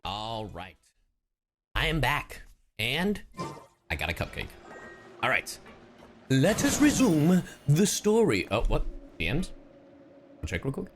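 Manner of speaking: ordinary delivery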